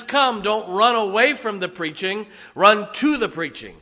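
loud, high revival preaching; fiery preaching. Acted. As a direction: preach it with full fire. Preaching at full force.

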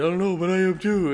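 high, tearful answer through tears; tearful reply. Retold or imitated. Wife answering her crying husband, high and tearful. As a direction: answer while crying too. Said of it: "She said".

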